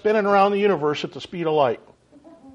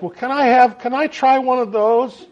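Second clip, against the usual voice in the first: hopeful request; 7 semitones higher, 3 dB louder than usual. Retold or imitated. Retold request to nurse.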